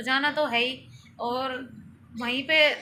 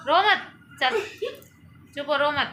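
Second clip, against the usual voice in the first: raised, half-laughing coaxing; 3 semitones higher than usual. Real, not acted. Soothing crying child.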